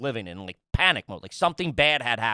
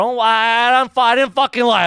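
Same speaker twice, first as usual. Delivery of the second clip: louder, higher heated defense; retelling arguing with wife, louder and higher; heated retort; 8 semitones higher, 8 dB louder than usual. Retold or imitated. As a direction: fire back defensively. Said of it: "I go".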